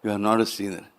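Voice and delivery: a hint of a loving goddess; gentle mother voice. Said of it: "The beautiful voice was saying".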